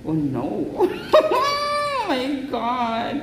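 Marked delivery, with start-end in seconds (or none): 0.7-2.0 high-pitched squealing laugh; shrieking laugh. Real, not acted. Thrilled reaction to the phone. (matinis na halakhak)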